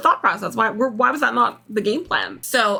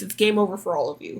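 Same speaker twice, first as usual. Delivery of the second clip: ordinary talk, nothing marked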